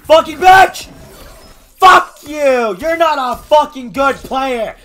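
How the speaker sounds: loud, high, raging yell; furious shout. Real, not acted. Gamer raging at the opponent who beat him. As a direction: tear into the opponent.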